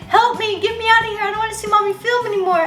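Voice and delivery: high, fast, whiny little dog begging to get out; pleading dog voice. Pretend. Dog Milo begging to escape the filming, high whiny voice. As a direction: voice the dog like a squeaky pleading pup. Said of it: "He's like".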